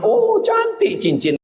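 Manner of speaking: put-on an old mother crying out in delight. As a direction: gush over the ring like a proud mother. Mother admires the ring.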